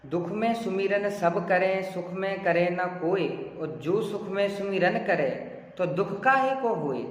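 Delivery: lilting spoken recital, phrase by phrase; spoken verse recitation. Shown as a demonstration. Teacher showing the tone that reading alone gives, spoken recitation. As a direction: read the couplet aloud, unsung. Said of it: "मैंने इसको भी सिर्फ पढ़ा है".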